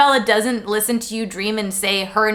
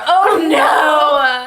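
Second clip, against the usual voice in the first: hammed-up anguished shout; mock dramatic cry; 4 semitones higher, 6 dB louder than usual. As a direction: wail it like a soap heroine. Dramatic retelling of Bella falling.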